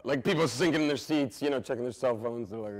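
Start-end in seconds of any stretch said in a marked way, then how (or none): none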